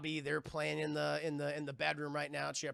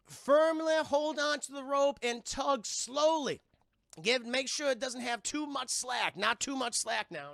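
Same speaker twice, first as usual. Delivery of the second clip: slow, higher medium's instructions; voz de médium; 6 semitones higher, 4 dB louder, slower than usual. Retold or imitated. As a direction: instruct slowly in a lightly put-on medium voice. Medium instructs in retold scene.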